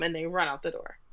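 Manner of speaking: ordinary talk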